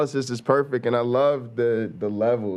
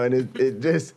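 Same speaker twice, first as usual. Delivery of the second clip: ordinary delivery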